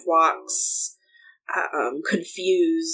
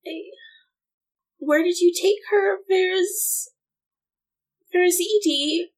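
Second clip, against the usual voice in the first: anxious; 12 semitones higher than usual. Acted. Frantic mother asks about daughter.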